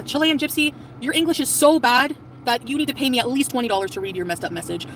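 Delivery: ordinary talk, nothing marked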